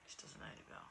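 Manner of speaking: hushed, choked-up trailing off; emotional. Real, not acted. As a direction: say it softly, overcome by real emotion. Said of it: "makes me emotional".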